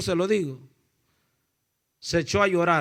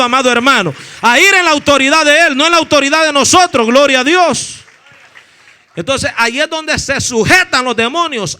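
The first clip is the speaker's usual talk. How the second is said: loud, driving sermon proclamation; preaching at full force; 3 semitones higher, 12 dB louder than usual. Real, not acted. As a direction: proclaim God's authority with full-voiced fervor. (prédica fervorosa a todo pulmón)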